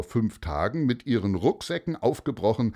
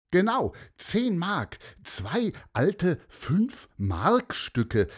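high, slow, thrilled announcement; excited call; 10 semitones higher, slower than usual. A narrator's reading. Sophia tells of the found coins, excited high call. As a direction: proudly reveal the treasure. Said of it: "rief Sophia begeistert".

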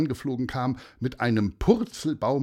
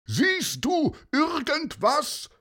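hoch und langsam nach oben gerufen; rufend; slower than usual. Acted. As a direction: ruf zum Vogel hinauf. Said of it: "rief Paulus zu ihm hoch". (Paulus ruft zum Vogel hoch, gedehnt)

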